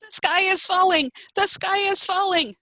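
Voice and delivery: high-pitched hen alarm; squawking chickens. A narrator's reading. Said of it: "squawked the chickens".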